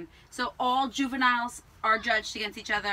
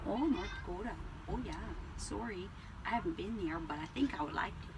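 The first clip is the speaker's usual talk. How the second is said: put-on North Dakota accent; 3 semitones lower, 13 dB quieter, faster than usual. Dinner guest hearing a North Dakota accent, softly put on. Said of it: "I busted out my North Dakota accent".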